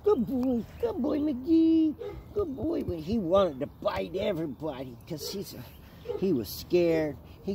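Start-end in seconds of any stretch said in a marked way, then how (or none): 0.0-2.8 much higher, slow mock dog-calling; imitating friend calling dog. Retelling how Doctor Bottoms called the dog, high mock sing-song. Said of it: "And he called".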